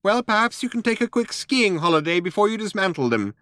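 high mouse voice, acidly sarcastic. A narrator's reading. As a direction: needle the old man with acid politeness, pitched high. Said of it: "said Frankie acidly".

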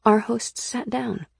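usual speaking voice

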